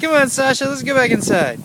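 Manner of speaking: loud, high call to the dog; calling out loudly. Real, not acted. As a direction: call the dog in over the wind. Calling dog over the wind.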